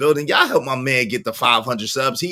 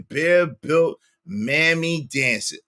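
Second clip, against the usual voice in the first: ordinary talk, nothing marked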